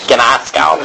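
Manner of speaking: laughing Boston gnosko; Boston accent. Shown as a demonstration. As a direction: throw in a joking Boston accent. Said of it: "That's like a Boston accent".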